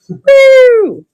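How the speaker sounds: high, loud whoop; excited whoop. Real, not acted. Seller enjoying the Lamb Chop card, high loud whoop. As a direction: let out a delighted cheer. Cheer after card joke.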